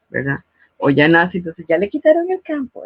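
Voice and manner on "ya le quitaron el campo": high, sing-song mock pity; teasing adult voice. Adults teasing the older child, high mock pity. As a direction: tease the child about losing his place.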